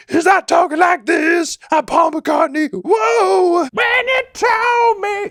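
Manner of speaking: over-the-top growly, throaty rock scream; very high shredded shout, wildly hammed up. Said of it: "growly, throaty vocal performances".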